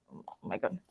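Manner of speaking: under-the-breath gasp of alarm; whispered oh my God. Real, not acted. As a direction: whisper it in dismay. Prop breaking in her hands.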